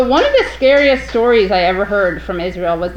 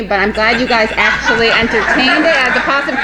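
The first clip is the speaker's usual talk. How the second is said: ordinary talk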